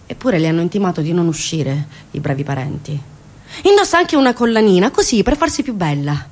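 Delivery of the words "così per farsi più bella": ordinary delivery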